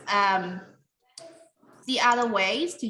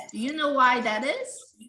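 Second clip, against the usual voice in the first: normal talking voice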